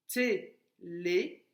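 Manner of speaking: "Télévision" slowly, té-lé-vi-sion; slow syllables. Shown as a demonstration. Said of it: "commence déjà par frapper le mot télévision".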